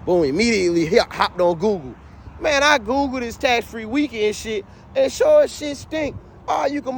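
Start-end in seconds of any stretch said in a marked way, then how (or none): none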